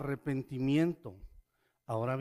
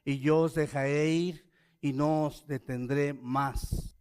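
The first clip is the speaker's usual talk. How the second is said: scripture reading; 3 semitones higher, 8 dB louder than usual. Acted. Preacher reads Pharaoh's plea, loud and solemn. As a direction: proclaim Pharaoh's plea to the congregation.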